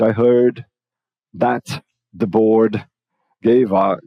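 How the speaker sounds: word groups set apart by clear pauses; careful, broken-up teacher demo; slow and paused. Shown as a demonstration. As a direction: say it deliberately, stopping after HEARD and BOARD. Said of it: "Ou eu vou falar pausadamente".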